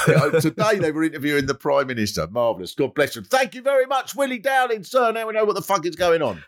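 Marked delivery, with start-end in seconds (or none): 3.2-6.4 high cheesy host sign-off; hammed-up presenter thanking guest; radio host voice.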